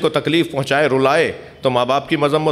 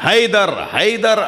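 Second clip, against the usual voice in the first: preaching at full force; 7 semitones higher, slower than usual. Acted. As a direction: drag out each Haider to the crowd. Preacher peaks on Haider naming. (پرجوش خطابت)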